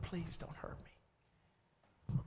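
very slow, hushed whisper; frightened plea. Retold or imitated. The demon begging Jesus for mercy, in a slow, fearful whisper. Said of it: "and said".

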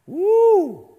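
high, loud celebratory whoop; cheering whoop. Real, not acted. Preacher cheers the hundred and twenty thousand converts, loud whoop. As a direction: whoop for the big win.

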